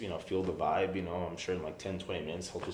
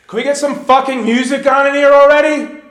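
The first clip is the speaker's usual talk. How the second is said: loud, high exasperated shout; angry yell; 14 semitones higher, 17 dB louder than usual. Retold or imitated. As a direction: demand it at full volume. [name] yelling for music.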